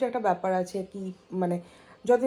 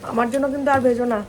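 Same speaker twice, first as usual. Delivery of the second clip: calling out; 4 semitones higher than usual. Real, not acted. Calling to family in kitchen.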